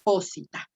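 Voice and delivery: Posita said as PO-si-ta; stressed on the first syllable. Shown as a demonstration. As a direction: put the weight on PO in Posita. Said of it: "se recorre una sílaba antes".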